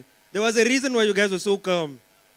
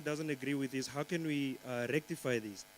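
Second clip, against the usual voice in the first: soft pleading; 3 semitones lower, 10 dB quieter than usual. Retold or imitated. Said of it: "you go like".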